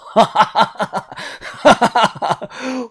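一长串癫狂的哈哈大笑; 音调拔高，疯了似的狂笑; 疯狂大笑. A narrator's reading. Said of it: "他疯狂的大笑". (癫狂长笑)

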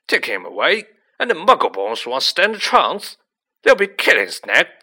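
loud, outraged warning; indignant giant bellowing; yelling. A narrator's reading. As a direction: shout it in alarm and protest. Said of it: "yelled Hagrid".

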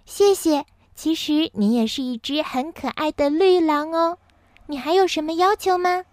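high-pitched, pleased fairy; 仙女道谢. A narrator's reading. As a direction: thank and ask in a lifted fairy voice. 仙女高兴地道谢询问. (仙女用高嗓音愉快地说)

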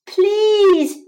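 high, loud pleading shout. A narrator's reading. Mouse begs the crickets, shouting. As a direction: shout the plea, pitch right up. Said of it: "shouted the mouse".

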